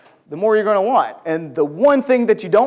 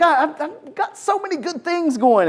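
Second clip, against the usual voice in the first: boastful Haman; 8 semitones higher than usual. Retold or imitated. Haman boasts to his friends.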